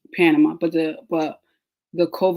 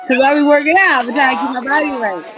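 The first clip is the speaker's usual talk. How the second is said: laughing brag; 7 semitones higher, 5 dB louder than usual. Real, not acted. Laughing brag after the joke.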